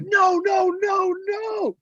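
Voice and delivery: high-pitched drawn-out mock yelling; mock crowd protest. Teammates cut off the wrong song, mock yelled protest. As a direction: cry out like dismayed teammates.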